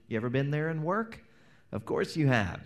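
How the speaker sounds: ordinary talk, nothing marked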